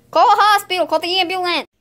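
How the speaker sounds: high, loud cry for help; play-acted emergency yell; mock-urgent shout.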